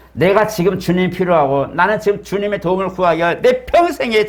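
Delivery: high-pitched, urgent plea of a blind beggar; crying out to Jesus. Acted. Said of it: "이 바디메오의 외침이". (주님께 간절히 외치듯)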